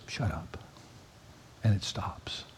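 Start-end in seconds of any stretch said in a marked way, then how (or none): 0.1-0.6 calm command. Retold or imitated. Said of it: "the God who just goes".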